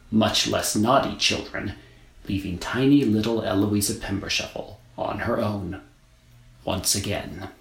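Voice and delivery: ordinary delivery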